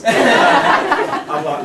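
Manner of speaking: laughing through the punchline. Real, not acted. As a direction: laugh out the last words.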